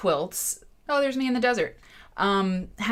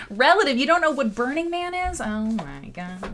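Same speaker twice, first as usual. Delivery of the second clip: high, loud mock shock, then slowing; playfully aghast, dragging out the final exclamation; mock disbelief; 7 semitones higher, 5 dB louder than usual. Host teases viewer who doesn't know Burning Man, mock disbelief.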